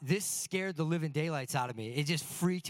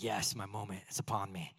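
hushed villain glee; 8 semitones lower, 6 dB quieter than usual. Retold or imitated. About to spring the scare.

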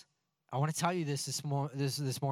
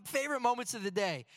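excited dad; 8 semitones higher than usual. Retold or imitated. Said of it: "I'm like".

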